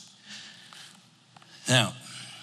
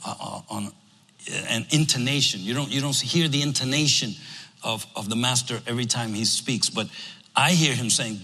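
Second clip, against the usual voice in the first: ordinary talk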